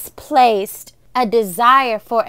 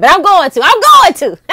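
defiant shout; 8 semitones higher, 11 dB louder than usual. Retold or imitated. Defiant retold self, loud.

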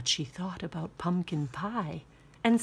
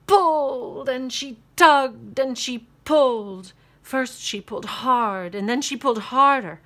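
louder and higher with effort; straining build; 5 semitones higher, 9 dB louder than usual. A narrator's reading.

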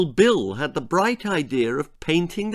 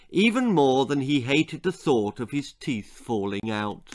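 plain reading voice

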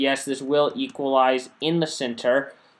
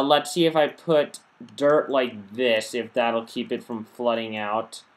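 normal talking voice

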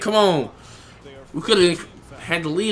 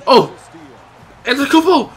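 normal talking voice

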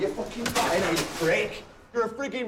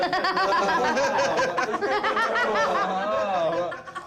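long laugh; 4 semitones higher than usual. Real, not acted. Laughs at introducing Gadget.

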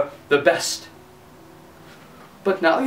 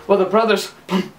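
plain reading voice

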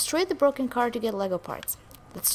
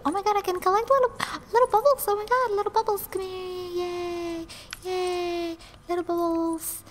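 excited squeal; 8 semitones higher than usual. Real, not acted. Gamer thrilled at tiny bubbles, high and squealing. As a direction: squeal with delight over a find. Finds collectible bubbles in game.

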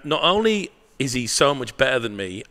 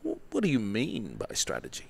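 mock questioner; 3 semitones higher, 3 dB quieter than usual. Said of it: "people say to me all the time".

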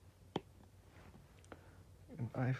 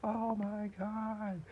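shocked gasp; 9 semitones higher, much slower than usual. Acted. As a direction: stretch the oh my god in shock. Villain exploding in his cartoon.

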